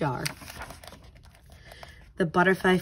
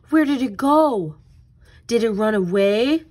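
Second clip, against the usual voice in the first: put-on worried child; 4 dB louder than usual. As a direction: gasp it out like a boy who lost his pet. Pete finds caterpillar gone.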